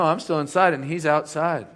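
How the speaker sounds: normal talking voice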